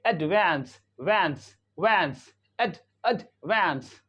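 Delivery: एडवांस as "ud-VANS", वैंस rhyming with "cat" vowel; American pronunciation. Shown as a demonstration. Teacher drilling the American form, open ae vowel. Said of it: "American English में क्या करते हैं".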